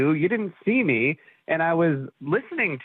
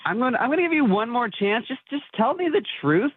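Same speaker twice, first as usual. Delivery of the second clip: higher-pitched, exasperated ultimatum; exasperated demand; 7 semitones higher than usual. Real, not acted. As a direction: demand honesty, fed up. Confronts nanny, demands the truth.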